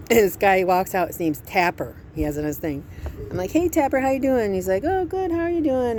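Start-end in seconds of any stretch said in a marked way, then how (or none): 3.5-4.5 bright, higher cheery hello; retold chirpy hello to the body shop guy; friendly greeting. 4.8-6.0 much higher, light imitation of Tapper; lightly voiced friendly mechanic; cheery reply.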